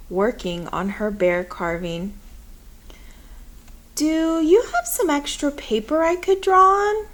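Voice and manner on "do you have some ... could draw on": put-on eager child's voice. A narrator's reading. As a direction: voice lifted higher for the young boy. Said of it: "I asked her".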